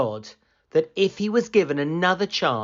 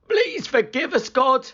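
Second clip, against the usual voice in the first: high, pleading cry to God; 11 semitones higher than usual. A narrator's reading. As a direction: sailors crying out for forgiveness. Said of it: "they cried".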